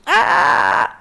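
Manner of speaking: sudden high, loud infant wail; imitating a baby crying for milk; baby's hungry cry. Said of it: "they speak like that when they want to eat".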